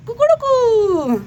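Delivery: high, slow drawn-out cock-a-doodle crow; rooster crow imitation. Host crowing to introduce her pet rooster, high drawn-out crow.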